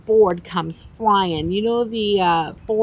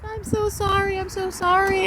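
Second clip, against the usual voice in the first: apologetic guy; 11 semitones higher than usual. Retold or imitated. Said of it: "the guy comes over and he's like".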